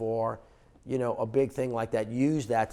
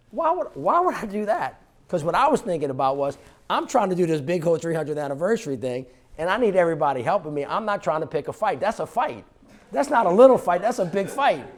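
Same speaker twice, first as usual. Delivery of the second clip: much higher and louder, fast, incredulous rant; exasperated outburst; 7 semitones higher, 8 dB louder than usual. Retold or imitated. Mayor to audience, retelling his shock at the request, loud incredulous rant. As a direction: throw up your hands: no way I'm picking that fight.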